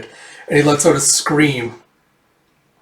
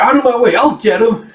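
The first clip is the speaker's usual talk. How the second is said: high, loud charge into the fight; acted aggressive yell, pitched up; battle yell; 9 semitones higher, 5 dB louder than usual. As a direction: push through the crowd shouting.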